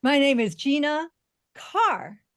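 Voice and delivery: GINA lifted, a pause, then CARR punched with a falling swoop; pause, then sing out CARR with a fall; musical punch. Shown as a demonstration. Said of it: "everyone listening can hear the difference".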